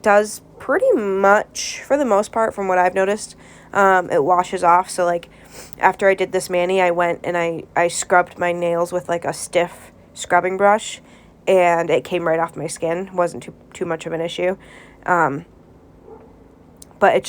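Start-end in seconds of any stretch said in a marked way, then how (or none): none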